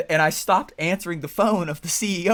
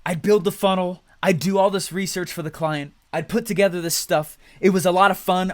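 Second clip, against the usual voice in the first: usual speaking voice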